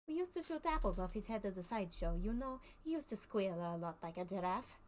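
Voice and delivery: put-on lightly French-accented circus showman. Circus man to the player, explaining his put-on accent in light French accent. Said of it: "I can do French".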